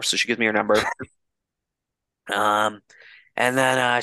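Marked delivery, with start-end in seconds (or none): none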